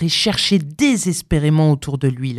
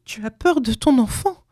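mildly agitated, higher-pitched mother; higher voice, a touch of reproach; struggling retort; 7 semitones higher than usual. A narrator's reading. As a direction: challenge him while pulling away. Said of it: "dit-elle en continuant de se débattre".

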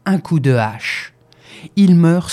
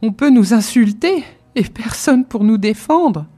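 crying; 5 semitones higher, 4 dB louder than usual. Acted. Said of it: "elle se met à pleurer".

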